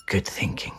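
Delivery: soft approving whisper. Acted. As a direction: whisper to yourself. Sneaking quietly.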